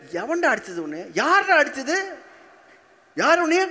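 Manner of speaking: acted parent demanding the culprit, pitch leaping up; high, insistent protective questioning; indignant parent. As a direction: demand who hit your child. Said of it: "முதல்ல கேட்டது".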